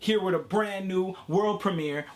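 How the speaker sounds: usual speaking voice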